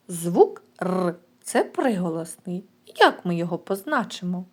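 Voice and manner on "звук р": usual speaking voice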